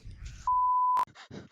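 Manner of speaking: high-pitched laugh. Real, not acted. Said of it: "my least scared amount of sound".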